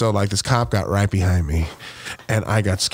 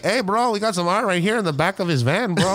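higher, pushy street seller; pitching art to a buddy, pitched up; hustler pitch; 7 semitones higher than usual. Retold or imitated. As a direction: hawk art from the back of a van. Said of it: "Be like".

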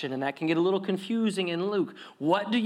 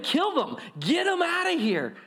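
higher, mock baying for blood; bloodthirsty crowd; 11 semitones higher than usual. Crowd demanding the tenants' punishment, mock angry. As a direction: call for their heads, hammed-up. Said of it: "five seconds ago were all in agreement".

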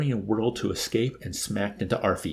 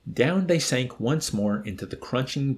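ordinary delivery, nothing marked

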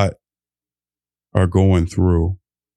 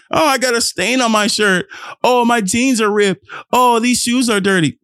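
high mock whine about clothes; whiny insecure self; 13 semitones higher than usual. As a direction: pitch it up like a fretful kid fussing over stains.

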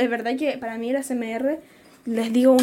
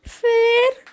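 high, slow sleepy friend voice, half laughing; voicing Ana; 10 semitones higher, 3 dB louder, much slower than usual. Friend waking her up over the stalled video, high and laughing. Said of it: "la Ana me dice".

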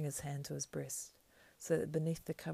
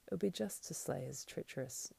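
ordinary delivery, nothing marked

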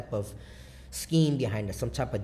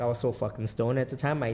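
ordinary delivery

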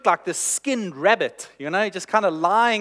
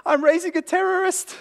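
high, loud mock-panicked yelp; panicked inner voice; 12 semitones higher, 4 dB louder than usual. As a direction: play the frightened little voice in your head. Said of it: "this little voice of".

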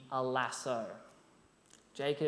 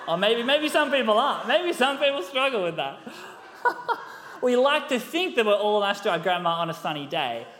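louder, talking through laughter; laughing; 5 semitones higher, 6 dB louder than usual. Real, not acted. Preacher laughing while joking to the congregation, loud. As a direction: chuckle through the joke about grandma.

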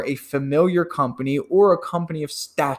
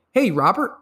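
upbeat greeting; 7 semitones higher than usual. Shown as a demonstration. Salesman demo opening cold call.

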